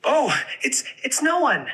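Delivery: high, squeaky mouse voice; little mouse. Acted. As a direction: squeak it out like a tiny frightened mouse. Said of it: "a mouse that snuck into the cave".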